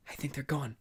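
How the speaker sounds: soft, hushed whisper. A narrator's reading. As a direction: breathe it out carefully. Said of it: "Brenda finally whispered".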